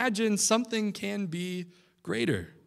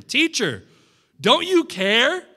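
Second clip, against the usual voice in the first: higher, louder, slow desperate cry; panicked plea; 7 semitones higher, 7 dB louder, slower than usual. Acted. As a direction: plead in fear of drowning. Panicked disciples wake sleeping Jesus.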